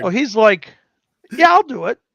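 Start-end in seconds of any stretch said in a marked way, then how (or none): 1.3-2.0 higher, louder mock eagerness; retold eager acceptance. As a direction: jump at the offer, acting it out. Player eagerly accepts dwarf reward.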